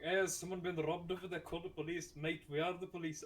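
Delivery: put-on drunk Scotsman. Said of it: "just imagine two Scottish".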